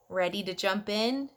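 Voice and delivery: plain reading voice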